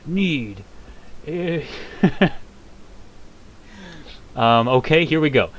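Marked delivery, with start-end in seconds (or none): 0.1-1.6 dragon voice. Acted. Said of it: "I heard the World Eater say". 1.7-4.3 laugh. Real, not acted. Laughs at own voice.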